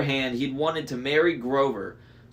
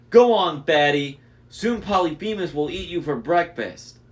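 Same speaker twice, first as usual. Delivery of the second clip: loud, high giant voice with menace; gloating Cyclops; 5 semitones higher, 5 dB louder than usual. A narrator's reading. Cyclops sends the sheep in, loud and gloating. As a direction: shove the sheep off with a booming jeer.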